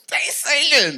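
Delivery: high, loud, laughing exclamation; a delighted cry of disbelief; thrilled exclamation. Retold or imitated. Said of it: "I looked at my, I said".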